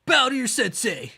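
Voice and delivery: high, loud sensei bark; sharp martial arts commands. Retold or imitated. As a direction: shout the command like a cocky sensei. Repeating the sensei line.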